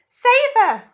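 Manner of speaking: loud high-pitched scream; a panicked mother screaming; mother screaming for help, very high. Acted. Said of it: "screamed Missus Salt".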